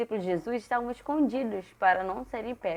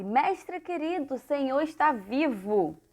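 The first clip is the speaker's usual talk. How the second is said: slow, higher-pitched acted wonder; joyful exclamation; 5 semitones higher, slower than usual. Mary Magdalene greeting the risen Jesus, mildly acted joy. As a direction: draw out the glad greeting, lifted in pitch.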